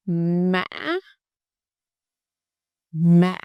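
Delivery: "Mã" said with a break in the middle, dipping then jumping up, almost like two syllables; broken ngã tone. Shown as a demonstration. Teacher demonstrating the broken ngã tone, "Mã" with a catch. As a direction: break the voice inside "Mã". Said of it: "Did you hear that break?".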